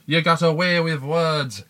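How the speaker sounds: clumsy put-on Scottish with throaty och; hammed-up comic Scottish; bad Scottish accent. Shown as a demonstration. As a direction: do a deliberately rubbish Scottish accent. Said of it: "That's how bad my Scottish accent is".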